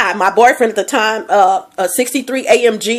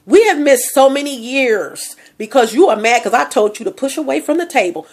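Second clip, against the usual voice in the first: ordinary delivery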